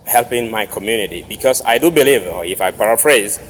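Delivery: usual speaking voice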